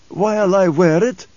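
put-on old man with a bitter edge; slight desert accent, bitter; bitter Fremen twang. A narrator's reading. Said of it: "a bitter twang and just a suggestion of Fremen accent".

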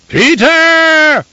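very high, loud bellowing call; bellowing for his mentat; shouting at the top of lungs. A narrator's reading. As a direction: bellow the name down the tunnels. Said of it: "he shouted at the top of his lungs".

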